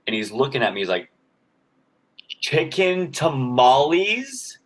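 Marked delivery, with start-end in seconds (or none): 2.4-4.6 high, slow, drawn-out questioning call; slow rising tamale vendor question; vendor's question. Retold or imitated. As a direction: stretch it out like a puzzled street vendor.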